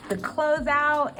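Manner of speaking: normal talking voice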